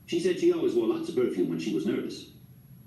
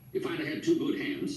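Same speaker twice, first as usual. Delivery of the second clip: put-on high, fuming old man; lightly voiced fuming grandpa. A narrator's reading. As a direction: grumble it out, put out.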